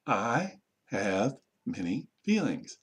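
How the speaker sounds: very slow, a pause after each word; word-by-word modelling. Shown as a demonstration. Teacher modelling a sentence for learners, slow word by word. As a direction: separate every word for learners to copy.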